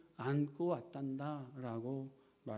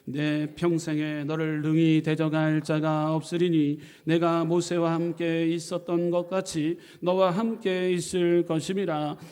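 loud, slow congregational scripture reading; full-voiced slow group recitation; loud reading together; 2 semitones higher, 11 dB louder, slower than usual. Shown as a demonstration. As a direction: read it out big and slow, all together. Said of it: "함께 큰 목소리로 읽도록 합니다".

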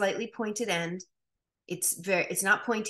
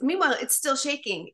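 normal talking voice

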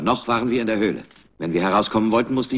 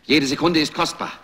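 urgent shout; 9 semitones higher than usual. Acted. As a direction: yell at the crew to hurry. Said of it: "schrie ich".

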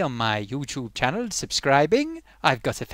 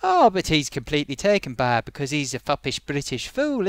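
normal talking voice

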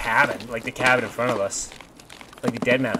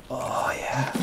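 whispering; 6 dB quieter than usual. Real, not acted. Savouring the rifle find.

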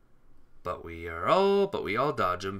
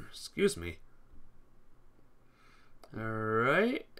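ordinary delivery

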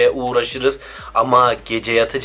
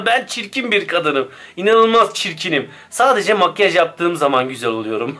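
laughing; 4 semitones higher than usual. Acted. Laughing self-mockery.

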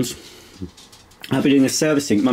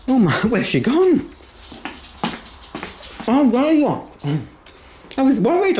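high dog-talk voice; 14 semitones higher than usual. Real, not acted. Talking to the dog.

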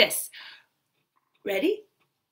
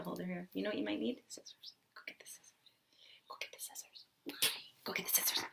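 whisper; 8 semitones lower, 24 dB quieter, faster than usual. Real, not acted. Whispered aside to child.

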